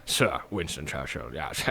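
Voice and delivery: low, slow, mumbled statesman voice; soft, deep, drawn-out impression; Winston Churchill. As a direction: play a ponderous old British leader introducing himself. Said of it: "Churchill, come on, come on, introduce yourself".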